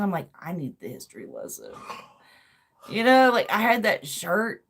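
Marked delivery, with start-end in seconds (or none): 0.4-1.7 low, soft self-mocking aside; sheepish admission. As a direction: drop it low and quiet, owning up. Sheepish admission about his old shirt.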